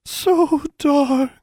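a cold dying plant moaning; whispered. Acted. Another corn plant whispers of the cold. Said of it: "whispered a third".

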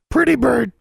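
high, loud squawking bird; parrot voice. Acted. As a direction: talk like a raven. Raven Charlie greets her.

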